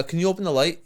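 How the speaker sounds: usual speaking voice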